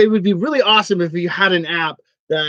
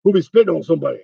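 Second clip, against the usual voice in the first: lightly voiced Mister T, tough-guy swagger; a hint of Mister T scolding the fool; Mister T impression; 3 dB louder, faster than usual. Host jokes as Mister T about spitting, lightly voiced tough guy. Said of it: "So Mister T is involved now".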